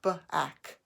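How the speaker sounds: "Back" broken into b, then ack; tapped-out sounds. Shown as a demonstration. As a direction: tap out "Back", first consonant apart. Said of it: "Let's tap back".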